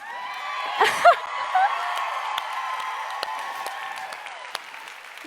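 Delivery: high, breathy shriek of laughter. Real, not acted. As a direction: let out a wild shrieking laugh. After her bold hot-ass line.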